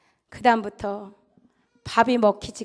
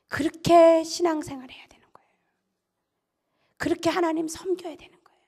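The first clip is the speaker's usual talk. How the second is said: hushed, unvoiced emphasis; preacher's whisper; 3 semitones higher than usual. Preacher whispers to the congregation for emphasis. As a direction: drop to a whisper to draw them in.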